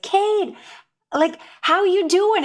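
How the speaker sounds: high, loud, drawn-out cheery hello; big, stretched-out greeting; upbeat caller greeting. Retold or imitated. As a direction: play an over-friendly phone caller. Caller's hearty greeting, acted.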